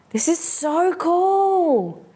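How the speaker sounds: high, drawn-out wonder on "so cool"; slow, high delighted exclamation; delighted marvelling. Real, not acted. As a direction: stretch it out in high-pitched amazement. Delighted at what she finds.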